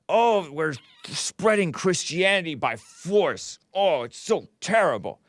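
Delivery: strained mocking whine; mocking whiny voice. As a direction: whine it like a fake moraliser. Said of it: "When I talk like that, I make my throat itch".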